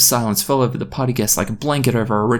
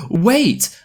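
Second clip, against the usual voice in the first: high-pitched exclaimed interruption; calling halt; 9 semitones higher than usual. Acted. Someone interrupting the party, urgent exclamation. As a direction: cut in with a high cry.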